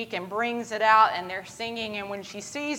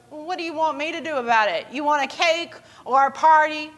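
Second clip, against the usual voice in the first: quick, high-pitched challenge; dismissive retort; 7 semitones higher, 5 dB louder than usual. Retold or imitated. Friend answers the birthday girl, dismissive. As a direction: throw it back as a pushy question.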